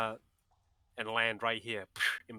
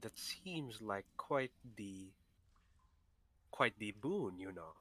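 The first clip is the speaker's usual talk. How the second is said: slow, soft murmur trailing away; whispered aside; 2 semitones lower, 7 dB quieter, slower than usual. Acted. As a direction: drop your voice as if conferring privately. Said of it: "whisper to Anor".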